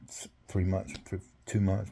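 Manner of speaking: normal talking voice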